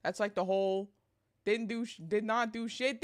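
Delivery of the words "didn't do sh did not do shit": ordinary talk, nothing marked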